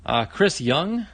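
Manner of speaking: ordinary delivery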